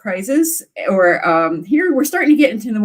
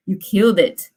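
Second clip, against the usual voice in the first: ordinary talk, nothing marked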